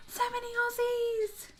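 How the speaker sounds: high, slow, gushing delight; delighted squeal. Real, not acted. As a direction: squeal it out with joy. Delighted by Aussie viewers in chat.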